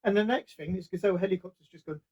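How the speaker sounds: ordinary talk, nothing marked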